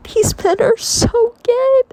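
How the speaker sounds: loud, very high-pitched squeal; excited outburst. Real, not acted. Reader gushing to viewers about Dorian's men, excited squeal. Exclaiming over the book's queer subtext.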